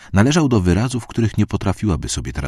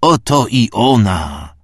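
slow loud proclamation; 5 semitones higher, 4 dB louder, much slower than usual. A narrator's reading. Priest proclaims at altar.

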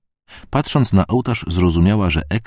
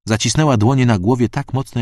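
plain reading voice

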